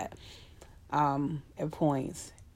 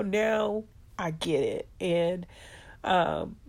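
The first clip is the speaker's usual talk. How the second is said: ordinary delivery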